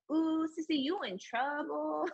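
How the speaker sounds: put-on high, teasing little-girl voice; drawn-out sing-song taunt of a big sister; little missy. Six-year-old teasing her little sister about trouble, gloating. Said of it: "she's like little Missy".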